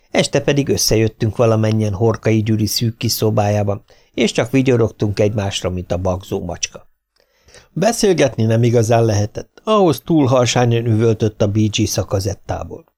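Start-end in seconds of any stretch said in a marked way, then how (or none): none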